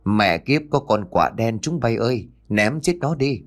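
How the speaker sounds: ordinary delivery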